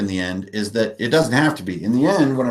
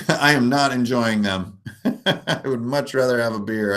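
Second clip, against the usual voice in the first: amused, talking through a laugh. Real, not acted. Host answering chat about a drink, laughing. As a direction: chuckle through the complaint.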